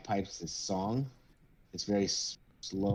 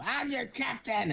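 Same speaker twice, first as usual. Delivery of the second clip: high, drawn-out cry; protesting captain; slower than usual. Acted. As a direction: yell it as they carry you off. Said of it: "Come on, let's go".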